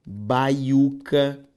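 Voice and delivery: baiuca dito devagar e bem articulado; carefully pronounced. Shown as a demonstration. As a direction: pronuncie baiuca com calma, sílaba por sílaba. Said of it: "é o caso de". (baiuca pronunciado com cuidado)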